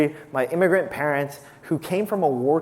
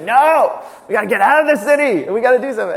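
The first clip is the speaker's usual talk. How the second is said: higher and louder, pushing hard for a trip; eager insistence; 7 semitones higher, 7 dB louder than usual. Retold or imitated. Wife urging her workaholic husband to travel, excited and insistent. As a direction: insist like she can't wait to leave.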